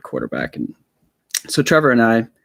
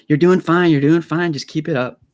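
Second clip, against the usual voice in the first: lightly imitated dad, raised pitch; rally a struggling kid, a touch louder; encouraging dad; 6 semitones higher, 5 dB louder than usual. Dad cheering on his tearful son, lightly voiced encouragement.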